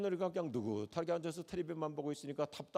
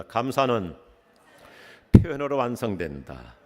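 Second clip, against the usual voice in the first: loud, low, slow proclaiming; call-and-response recitation; 7 semitones lower, 11 dB louder than usual. Shown as a demonstration. Pastor leads congregation to repeat, loud and low. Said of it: "같이 한번 따라서 하겠습니다".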